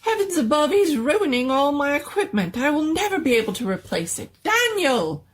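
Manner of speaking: wailing over his ruined equipment, calling for help; fast, shrill-pitched panic ending in a call; frantic doctor. Acted.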